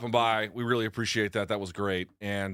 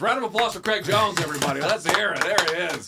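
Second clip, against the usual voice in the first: announcer voice; 6 semitones higher, 4 dB louder than usual. Acted. Host announcing guest, applause.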